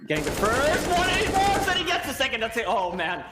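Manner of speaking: high, loud rising play call; excited. Real, not acted. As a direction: call the clutch like it's huge. Live play-by-play of a clutch.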